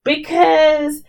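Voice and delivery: high, slow mimicry of her live rant. Mocking a rapper's live-stream confession, high slow imitation. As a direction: parody her boastful confession. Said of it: "shouting in the echoey room, saying".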